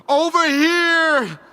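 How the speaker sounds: high, slow strained call; a high desperate call, slowed; retold shout. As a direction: shout the warning across the fight. Mimicking Mace's warning shout.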